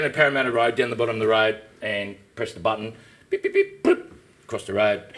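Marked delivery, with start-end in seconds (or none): 3.3-3.8 high mimicked crossing signal beeps. At the crossing on Parramatta Road, high imitated beeps. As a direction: squeak out the beeps of the crossing button.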